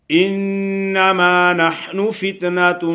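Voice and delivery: slow melodic tajweed recitation; Quran recitation. Shown as a demonstration. Teacher reciting a verse for the student, slow and melodic. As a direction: recite slowly with measured tajweed.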